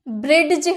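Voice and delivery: ब्रिज with a hard D before the J, 'brid-j'; ब्रिज split as bred-j; wrong sounded D. Shown as a demonstration. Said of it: "कभी आपने बोला है?".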